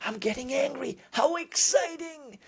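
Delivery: mock-excited inner voice, treating your own anger as a game; playful mock delight. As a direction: play it like anger is a fun surprise. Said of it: "Play with it".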